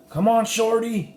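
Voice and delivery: calling out. Acted. Slim calling Jack to follow, loud and high. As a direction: shout it across the herd.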